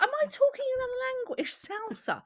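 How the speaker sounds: high, quick exasperated protest; incredulous exasperation. Acted. Customer misunderstood by another Brit, incredulous. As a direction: protest at not being understood. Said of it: "that's what it feels like sometimes".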